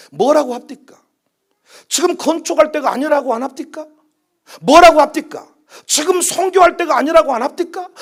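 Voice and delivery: high, insistent volley of rhetorical questions; I-told-you-so reproach. Acted. Church members reproaching the pastor for his plans, indignant.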